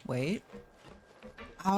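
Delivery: normal talking voice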